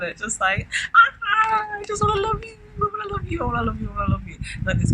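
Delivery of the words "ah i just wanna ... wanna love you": put-on 1 giddy lovestruck child. Voicing giddy lovestruck inner child.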